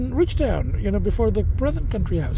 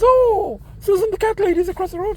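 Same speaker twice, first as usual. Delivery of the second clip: much higher, louder, fast lady's voice; the neighbour lady calling out brightly, pitched up; imitating the lady; 9 semitones higher, 3 dB louder than usual. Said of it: "she goes".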